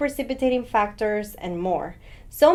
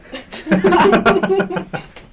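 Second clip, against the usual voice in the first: loud, high burst of laughter; big laugh; 8 semitones higher, 8 dB louder than usual. Real, not acted. Doctor laughing loudly at his own pun, high and loud. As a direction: crack up at your own joke.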